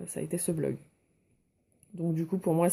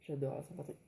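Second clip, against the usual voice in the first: laughing; 2 semitones lower, 12 dB quieter, faster than usual. Real, not acted. Laughs about the date mistake.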